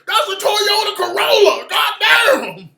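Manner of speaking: loud, high-pitched hammed-up outburst; exaggerated shriek of alarm; mock yelling. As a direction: holler as if mocking overexcited onlookers. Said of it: "Yelling for no damn reason".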